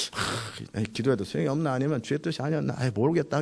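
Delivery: a quiet, resigned mumble to oneself; discouraged. Acted. Believer giving up after a failed prayer, discouraged.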